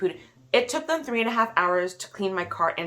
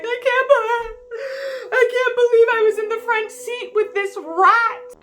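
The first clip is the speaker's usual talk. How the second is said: high wailing mock sob, louder; imitated bawling; 6 dB louder than usual. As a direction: wail it like a crying teenage daughter. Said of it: "My daughter started bawling".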